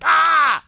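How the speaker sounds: high, mock-enraged "Ah!"; short scream imitating an angry driver; imitated traffic yell. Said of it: "yelling at people in traffic".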